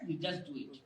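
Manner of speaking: low, soft aside; soft instruction. Real, not acted. Coach urging the class to practise, low and soft.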